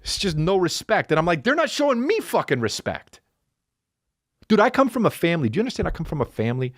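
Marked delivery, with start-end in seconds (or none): 1.4-3.2 high, aggrieved acted complaint; indignant protest. Husband argues he is the disrespected one, indignant. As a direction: protest in a raised pitch. Acted quarrel about respect.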